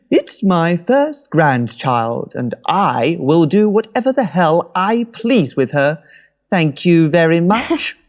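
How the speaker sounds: slow, loud mock-haughty Mum; lightly voiced proud grandmother, mock indignant; imitating Mum. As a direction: ham up a defiant grandmother. Mimicking Mum's defiant party speech.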